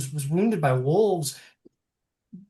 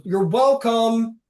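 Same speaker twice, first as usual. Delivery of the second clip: sarcastic thanks; 8 semitones higher, 8 dB louder, much slower than usual. Acted. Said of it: "and goes".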